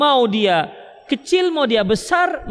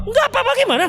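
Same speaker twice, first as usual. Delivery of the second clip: much higher, strained demand; exasperated husband; 14 semitones higher, faster than usual. Acted. Husband demands an answer from his wife, exasperated and much higher. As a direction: lose patience and push hard.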